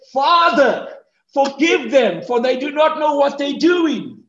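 Jesus crying out from the cross, acted by a preacher; shouted plea. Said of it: "Remember the first shout he shouted?".

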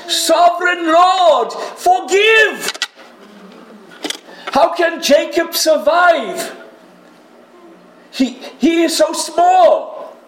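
anguished shout, pleading for mercy; desperate cry to God. Acted. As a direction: beg God at the top of your voice. Amos crying out to God.

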